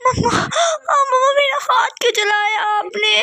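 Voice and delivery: very high, sobbing scream of pain; pained crying scream. Acted. Burned girl screaming at mother why she did it. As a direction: scream through burning pain.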